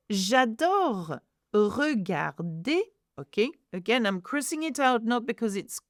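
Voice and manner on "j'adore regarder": "j'adore regarder" slowly, syllable by syllable; teacher spelling out "regarder" by ear, final r silent; slow pronunciation demo. Shown as a demonstration. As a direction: draw out each sound of "regarder", ending on é. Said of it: "it's because the sound is E".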